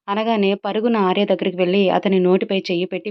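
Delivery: plain reading voice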